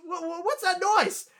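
high, loud mock panic; freaking out. Woman hearing strange noises from her lunch basket, freaking out. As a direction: stammer it out in shrill, hammed-up alarm. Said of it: "she's freaking out".

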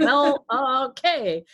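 resigned husband. Retold or imitated. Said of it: "My husband's like".

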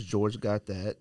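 usual speaking voice

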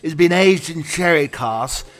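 a plummy English wine hustler laying on the charm; posh English accent. Acted. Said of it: "show somebody with an English accent".

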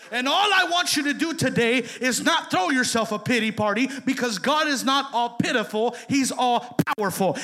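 loud preacher's declaration; preaching. Acted. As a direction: declare it over the congregation. Preacher rising to full force.

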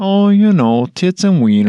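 playful exaggerated Fargo accent as a greeting reply. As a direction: snap into a hammed-up Fargo lilt. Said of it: "That's the only thing you say in that accent".

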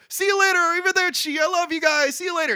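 hammed-up cheerful send-off; excited goodbye. Oliver waving off Elio's parents at the bus, over-the-top excited. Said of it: "he's, like, jumping up and down".

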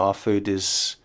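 usual speaking voice

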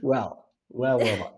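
ordinary talk

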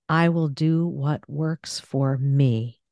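slow, landing on "me"; stress on ME. Shown as a demonstration. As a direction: put the final weight on "me". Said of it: "let me, uh, do one of these".

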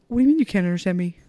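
fast, indignant mock retort; mocking the husband. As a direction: rattle it off like an offended man. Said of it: "he just looks at me like".

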